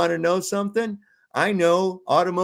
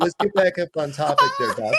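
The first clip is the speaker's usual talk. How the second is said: laughing. Real, not acted. Laughing while steering back.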